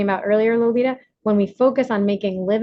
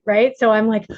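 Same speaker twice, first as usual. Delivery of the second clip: normal talking voice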